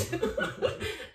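a bright high burst of laughter. Real, not acted. Remembering the mushrooms in Camden, laughing high. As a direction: laugh at the memory.

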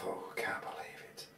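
breathless whisper to himself; whispered thought. Retold or imitated. Stung by jellyfish, thinking to himself, in a whisper. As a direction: hush it as a stunned private thought.

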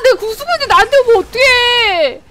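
high, loud protest; exasperated yelling. Real, not acted. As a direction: wail at the unfair attacker. Complains at enemy attacking him.

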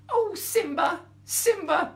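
high, loud pleading mouse squeak. Acted. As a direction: beg her, squeaking high. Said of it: "pleaded the mouse".

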